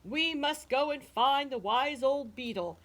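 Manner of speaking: high, loud shout easing slightly; shouted order. A narrator's reading. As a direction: burst out to silence a squabbling crowd. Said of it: "the chief frog shouted out".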